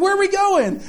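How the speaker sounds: put-on high-pitched child's question; mimicked childish eagerness; excited kids. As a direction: imitate kids piping up from the back of the van. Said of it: "and the kids are like".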